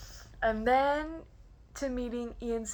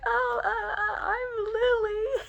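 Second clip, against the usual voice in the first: high, faltering self-introduction; starstruck stammer; 12 semitones higher, 3 dB louder than usual. Retold or imitated. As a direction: act out a flustered fan squeak. Her starstruck introduction acted out.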